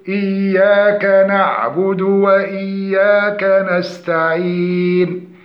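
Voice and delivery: slow tajweed recitation with long vowels; correct recitation. Shown as a demonstration. Teacher models how the verse must be said, slow recitation. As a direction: chant it evenly, holding each elongation. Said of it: "يجب أن نقول".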